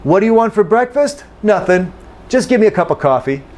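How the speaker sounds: fast, reduced everyday American dialogue; quick casual exchange at full American speed; normal speed. Shown as a demonstration. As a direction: run it off at natural speed, gimme and cuppa. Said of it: "So be ready for that".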